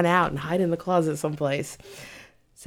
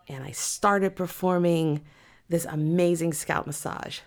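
ordinary delivery

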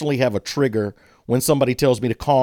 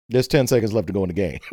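lower, fast and deadpan; unmoved Larry Bird; 7 semitones lower, faster than usual. Retold or imitated. Imitating Larry Bird's blank reaction in the stadium, lower and deadpan. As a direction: lower and quick, with no excitement. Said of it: "a shot of Larry Bird that's like".

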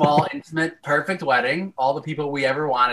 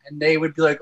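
normal talking voice

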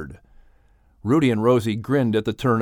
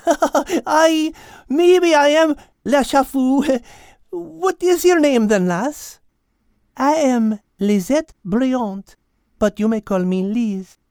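high, laughing French lady cat; amused French cat; 12 semitones higher, 3 dB louder, slower than usual. Acted. Cat correcting Al, high amused French voice. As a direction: charm him with a French lilt.

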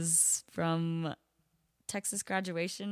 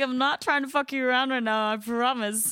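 higher, louder, pleading and fed up; exasperated honesty; 7 semitones higher, 7 dB louder than usual. Retold or imitated. Server to seated guests, exasperated and pleading. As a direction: drop the act and level with the stubborn guests. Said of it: "I'm like".

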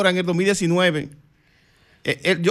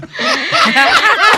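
high, loud burst of laughter; 8 dB louder than usual. Real, not acted. Guest cracking up at his own answer, high loud laugh.

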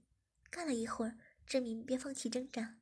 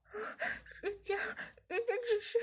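high, broken, tearful moan; moaning woman; 9 semitones higher than usual. A narrator's reading. Zhiming stammers to the boss, high and tearful. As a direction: stammer out between moans.

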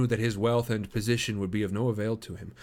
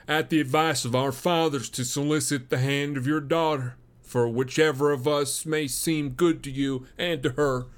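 a hint of a higher, smug young suitor; lightly voiced self-assured rival; 5 semitones higher, 4 dB louder than usual. Stangerson voiced in dialogue.

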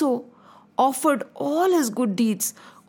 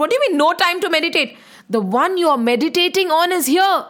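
louder and fast, bursting with news; eager disciple telling his guru that God has arrived, louder and fast; excited exclaim; 5 semitones higher, 4 dB louder, faster than usual. Acted. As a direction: blurt it out, thrilled.

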